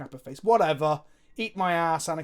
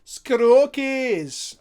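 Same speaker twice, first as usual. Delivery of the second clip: louder, higher hammed-up Scottish accent; Scottish impression; 8 semitones higher, 11 dB louder than usual. Streamer overdoing a Scotsman for chat, loud mock Scottish accent. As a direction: ham up the Scottish accent on the name. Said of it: "That's my impression of a Scotsman".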